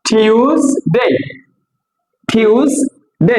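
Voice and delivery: টিউসডে in two parts, TYOOS, DAY; correct pronunciation. Shown as a demonstration. Teacher drilling after asking learners, correct pronunciation. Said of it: "কি হবে এটা".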